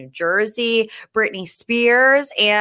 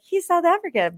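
pitched way up, light and breezy; mock matter-of-fact quote; 11 semitones higher than usual. Host quoting herself switching his nationality, high and breezy. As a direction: chirp it brightly as a quick correction. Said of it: "I'll be like".

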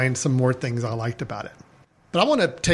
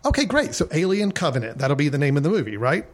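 higher studio executive voice, a bit quick; high-pitched nasal voice. Retold or imitated. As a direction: play the pushy studio suit, higher. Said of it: "a little more high-pitched, uh, nasally voice".

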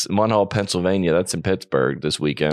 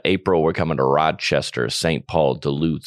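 normal talking voice

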